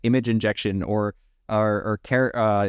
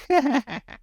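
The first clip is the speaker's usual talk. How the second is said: high laugh. Real, not acted. Laughs at own joke.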